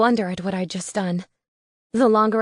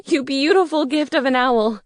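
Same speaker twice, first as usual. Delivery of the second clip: higher, louder grateful gush; overjoyed; 5 semitones higher, 5 dB louder than usual. A narrator's reading. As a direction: gush with relief. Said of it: "I cried".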